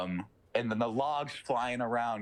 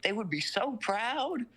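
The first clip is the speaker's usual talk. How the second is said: devil taunting; 6 semitones higher than usual. Retold or imitated. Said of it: "he's like".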